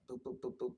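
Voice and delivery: high, mock robotic boops; machine beeps. Describing how the DNA scan works, mock robot beeps. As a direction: imitate the scanner beeping.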